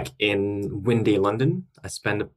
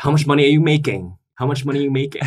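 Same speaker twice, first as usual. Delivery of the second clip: louder, fast, repeated prying question; nosy relative; 6 semitones higher, 6 dB louder, faster than usual. Retold or imitated. Guest voicing a nosy relative at New Year, loud and fast. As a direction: pester like a nosy aunt. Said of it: "they'll be like".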